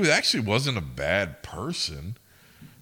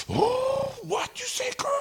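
very high falsetto imitation of offended customers; mock outraged squeal; 3 dB louder, slower than usual. Ladies in the car answering back, mock outraged squeal.

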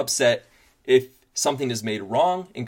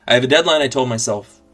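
stressed outburst; 5 semitones higher, 10 dB louder than usual. Acted. Stressed self-talk about deadline.

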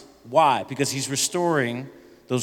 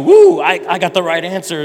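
mock triumph; 3 semitones higher, 10 dB louder than usual.

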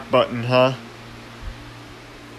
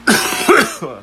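hacking cough; 7 semitones higher, 5 dB louder than usual. Real, not acted. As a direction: hack it out like you're sick. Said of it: "hacking my lungs out over here".

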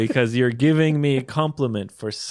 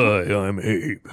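a slightly deeper put-on voice; practising a deeper radio voice; trying a deeper voice; 4 semitones lower than usual. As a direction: try to sound deeper. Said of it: "I need to practice trying to speak in a more deep voice".

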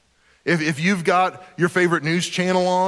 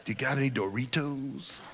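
low, much softer stoner voice; mock weed smoker; 4 semitones lower, 10 dB quieter than usual. Stoner asking for snacks, mock weed-smoker voice. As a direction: put on a caricature stoner, hushed and low. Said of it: "the way I imagine all weed smokers talk".

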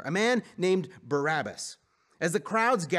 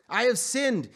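higher-pitched confession; earnest declaration; 8 semitones higher, 4 dB louder, slower than usual. A narrator's reading. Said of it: "he declared".

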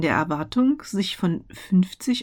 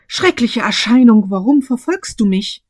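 frightened outcry; 4 semitones higher, 10 dB louder than usual. A narrator's reading. Scrooge terrified before ghost.

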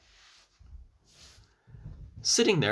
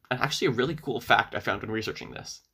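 ordinary delivery, nothing marked

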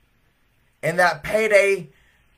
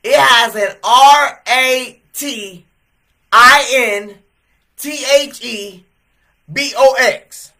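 loud, high and slow, each letter separate; spelled out letter by letter; 6 semitones higher, 9 dB louder, slower than usual. Retold or imitated. Young man spelling it out to the clerk, slowly and loud. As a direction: spell "R A T" and "B O X" deliberately. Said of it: "He literally says".

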